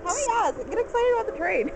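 excited. Real, not acted. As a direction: cheer like the train just arrived. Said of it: "I get so excited".

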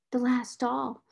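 little mournful, odd-sounding voice. A narrator's reading. Orphaned girl gazing at her last doll, mournfully. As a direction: echo it wistfully, understated. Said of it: "mournful voice had an odd sound".

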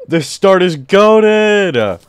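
high, slow, loud cheer; drawn-out thrilled exclamation; excited. Real, not acted. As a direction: stretch it out in delight. Surprised by great world start.

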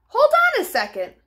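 high, fast hold on; sudden alarm. Acted. Dog sensing Taco's threat, quick high alarm. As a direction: stop short in alarm.

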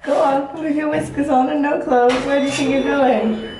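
ordinary delivery

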